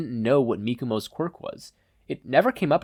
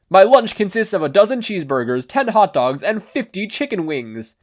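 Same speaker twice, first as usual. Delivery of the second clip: higher, loud hero voice; All Might impression; 8 semitones higher, 8 dB louder than usual. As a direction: boast like a booming hero. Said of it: "All Might's roaring muscles".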